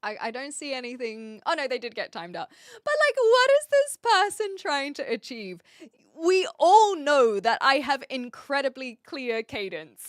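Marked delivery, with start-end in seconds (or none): 2.8-5.6 pushed up in loud disbelief; pressing the question hard at chat; louder, higher repeat. Real, not acted. Irritated streamer demanding why the chat critic did this, loud. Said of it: "repeated louder".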